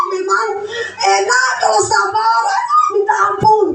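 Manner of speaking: high, whiny mock complaint; mock complaining congregant. Congregant mocking the pastor's advice, high whiny voice. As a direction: lampoon a congregant groaning about being told to be patient.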